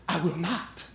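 low, hushed refusal; quiet insistence. Acted. Peter answering Jesus a second time, quiet stubborn refusal. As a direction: dig in softly, voice dropped.

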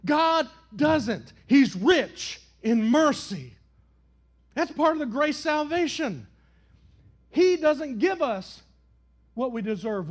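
loud, high, rising preacher's cadence; impassioned pulpit preaching; full-force preaching. Acted. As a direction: build the sermon to a shout.

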